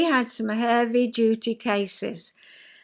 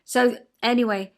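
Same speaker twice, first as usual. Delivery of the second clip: normal talking voice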